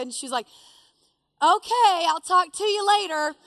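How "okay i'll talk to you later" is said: louder, higher mock sign-off; dismissive sign-off. Mother-in-law ending the phone call, dismissive. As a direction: imitate her giving up on the call.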